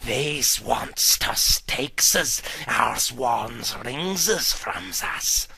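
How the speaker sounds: low, slow, whispery Gollum hiss. Acted. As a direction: creep and hiss over the precious. Gollum voiced in story.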